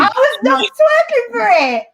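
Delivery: high slow laughing protest; laughing denial. Real, not acted. Denying a tease with a laugh.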